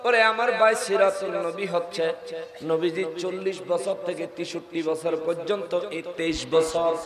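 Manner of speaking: ordinary talk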